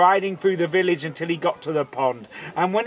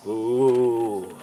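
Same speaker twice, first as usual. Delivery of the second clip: spooky ooh; 7 semitones lower than usual. Shown as a demonstration. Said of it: "That was more spooky".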